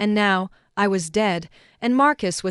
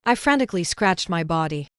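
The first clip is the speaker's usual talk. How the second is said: plain reading voice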